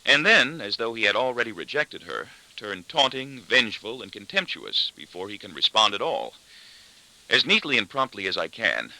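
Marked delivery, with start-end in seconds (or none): none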